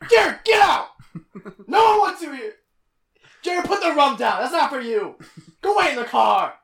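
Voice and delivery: high-pitched mock scolding; hammed-up angry shouting. Host ordering imaginary twin Jared out, mock angry shouting. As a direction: yell as if banishing an unwanted twin.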